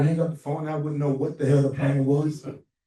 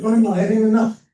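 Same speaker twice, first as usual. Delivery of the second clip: louder, higher, quick insistence; fired-up Ron; 8 semitones higher, 8 dB louder, faster than usual. Retold or imitated. Ron telling Harry the schedule isn't enough, fired up. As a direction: push back with eager energy.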